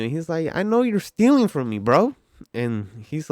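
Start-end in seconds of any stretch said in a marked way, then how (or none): 0.5-2.2 high, loud imitated accusation; pressing hard. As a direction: act out a customer leaning hard on the cashier. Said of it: "pressing me all hard".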